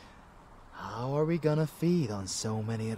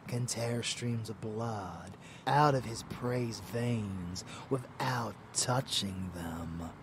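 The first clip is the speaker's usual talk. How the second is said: ordinary talk, nothing marked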